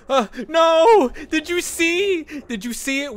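high, loud panicked cry; startled yelp. Real, not acted. Gamer nearly falling, yelping in alarm. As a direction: jolt up in alarm.

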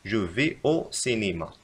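slow, careful correction; slow dictation. Shown as a demonstration. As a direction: dictate it slowly. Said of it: "يجب علينا أن نكتب".

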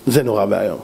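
ordinary delivery

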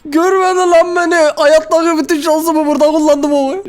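babbling elated disbelief; excited relief. Real, not acted. Streamer gloats to viewers after dodging the enemy, high excited relief.

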